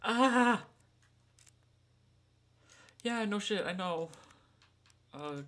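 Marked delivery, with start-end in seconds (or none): 0.0-0.6 loud high cry of fright; startled yell. Real, not acted. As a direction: yelp in fright. Startled in game.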